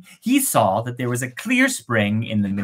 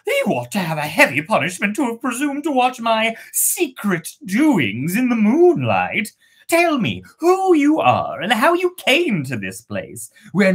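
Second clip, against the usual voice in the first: higher indignant maiden voice; maiden scolding; 7 semitones higher than usual. Acted. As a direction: scold the intruder imperiously.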